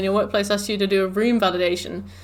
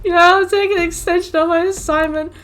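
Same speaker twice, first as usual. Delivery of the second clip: much higher, mock-eager grab at the offer; eager relief; 9 semitones higher, 4 dB louder than usual. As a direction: jump at it like a relieved student. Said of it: "And I was like".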